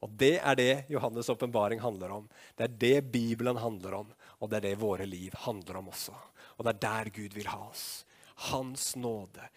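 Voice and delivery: normal talking voice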